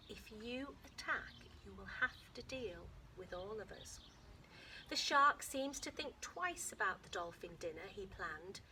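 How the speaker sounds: lively read-aloud to a class, lifting the story lines; story reading to children. Acted. Teacher reading a dolphin book aloud to her class, lively storytelling. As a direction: read it to the kids like an adventure.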